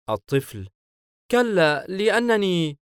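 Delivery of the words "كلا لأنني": put-on high-pitched, hesitant little boy; flustered child voice, pitched well up. Child flustered by Hayim's question, high flustered voice. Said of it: "لارتباك الطفل".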